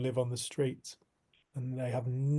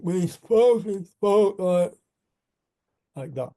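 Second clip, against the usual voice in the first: slurred, strained, higher and louder, slowed right down; stroke survivor's voice; 5 semitones higher, 6 dB louder, slower than usual. Retold or imitated. Imitating the homeless stroke survivor he met. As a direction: mimic a man who can barely speak after a stroke. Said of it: "I'll do his voice just once so you can get a feel".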